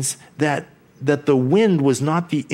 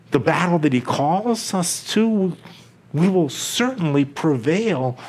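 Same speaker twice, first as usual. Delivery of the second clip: ordinary talk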